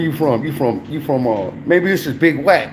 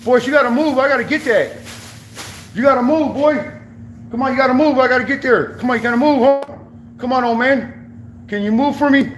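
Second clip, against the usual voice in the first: higher, coaxing calls to the dog; urging the dog; 5 semitones higher than usual. Real, not acted. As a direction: chivvy the dog along, pitched up. Coaxing the slow dog to move.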